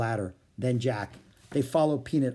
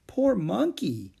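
slow, higher-pitched sympathy; pitying; 7 semitones higher, much slower than usual. Acted. Annie feeling for the poor monkey, pitying. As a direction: draw it out with pity.

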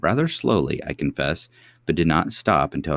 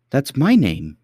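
put-on louder high girlish cry; surprised little princess, higher; cartoon princess; 8 semitones higher, 6 dB louder, slower than usual. A narrator's reading. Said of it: "cried the princess".

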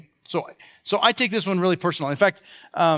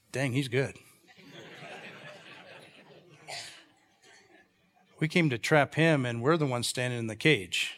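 lower, softer, grudgingly impressed; grudging admiration; 6 semitones lower, 7 dB quieter than usual. Acted. Frustrated Pharisees marveling at him, grudging admiration. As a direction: admit defeat under the breath. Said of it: "were frustrated and ticked, but they were going".

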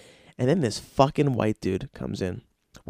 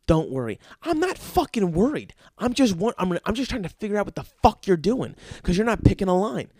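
higher, exasperated ranting; angry rant again; 4 semitones higher than usual. Acted. Comedian vents to Zoe about the guy, high and exasperated. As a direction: vent the whole grievance, fast and fed up.

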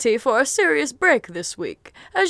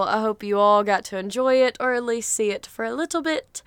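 usual speaking voice

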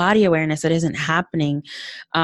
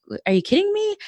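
retold disbelief; faster than usual. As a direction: voice shooting up high, incredulous. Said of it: "just mind blown".

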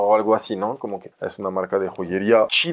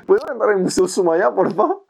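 a touch higher, mock clueless asking; clueless newcomer; 6 semitones higher than usual. Broke visitor asking to enter the museum, lightly mocking clueless voice. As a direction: play the broke nobody asking politely. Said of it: "Y tú entras así de pendejo".

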